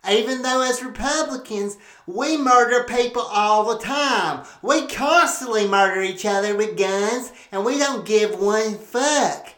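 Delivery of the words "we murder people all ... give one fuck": usual speaking voice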